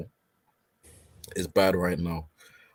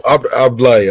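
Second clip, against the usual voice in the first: usual speaking voice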